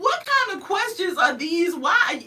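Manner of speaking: high, exasperated disbelief; incredulous. Retold or imitated. Vlogger baffled by troll questions, incredulous. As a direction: throw up your hands in disbelief.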